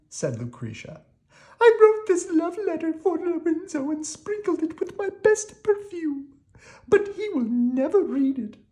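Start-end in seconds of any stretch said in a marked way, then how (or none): 1.6-8.6 very high, lamenting princess voice; a princess pouring out her sad tale. Acted.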